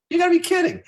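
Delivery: a sudden high, loud yelp of disbelief; incredulous squeal. Retold or imitated. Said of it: "I'm like".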